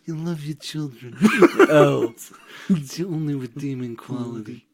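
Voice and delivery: bad Tyrion voice. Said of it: "really bad Tyrion voice".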